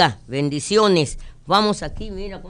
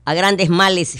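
ordinary talk

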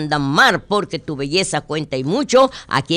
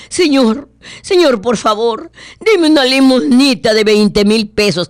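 beggar pleading; 6 semitones higher, 5 dB louder than usual. Acted.

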